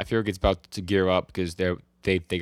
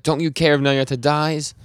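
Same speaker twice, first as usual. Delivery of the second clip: loud, higher imitation of angry disbelief; pissed off; 7 semitones higher, 6 dB louder than usual. Yoshida confronting Denji over Nayata, retold pissed-off demand. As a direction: demand it slowly, raised voice, retelling his anger. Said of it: "Yoshida starts looking pissed".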